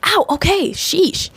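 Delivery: high, pained yelp into grumble; mock hurt, high; annoyed ow. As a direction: bitten and irritated. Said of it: "And she's like".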